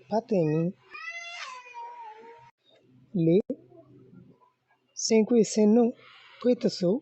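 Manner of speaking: loud, high-pitched word-by-word drill; lesson recitation. Shown as a demonstration. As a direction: call out each phrase like a teacher leading recitation. (بلند آواز میں سبق پڑھانا)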